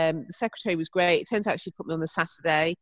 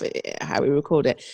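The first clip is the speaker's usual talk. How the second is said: normal talking voice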